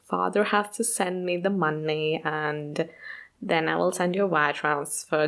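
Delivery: a hint of a breezy German accent; German heiress accent. Anna brushing off a creditor, lightly German-accented. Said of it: "she's like".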